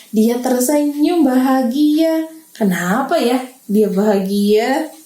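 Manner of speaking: usual speaking voice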